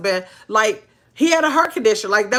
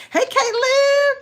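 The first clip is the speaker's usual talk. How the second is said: sing-song high greeting; slower than usual. Real, not acted. Greeting a viewer in chat.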